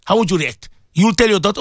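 normal talking voice

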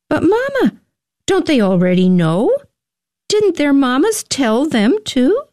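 put-on high, protesting child voice; childlike questions with high, swooping pitch; questioning little boy. A narrator's reading. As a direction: ask like a puzzled small boy, leaping up high. Little Samuel questions his mother.

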